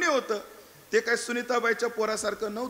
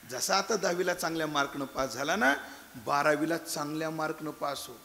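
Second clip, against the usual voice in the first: usual speaking voice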